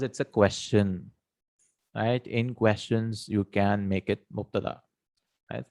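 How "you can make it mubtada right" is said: usual speaking voice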